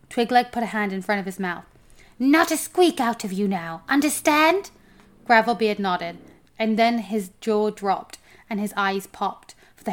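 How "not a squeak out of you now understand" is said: somewhat louder, lifting at the end; stern warning. A narrator's reading. Homunculus ordering the dwarf silent, mildly stern. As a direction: press him to agree on the last word.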